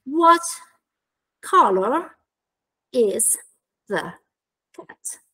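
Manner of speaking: one word at a time, long pauses between. Shown as a demonstration. Teacher building an example question for trainees, word by word. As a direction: say WHAT, COLOR, IS, THE, CAT as isolated words. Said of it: "per esempio".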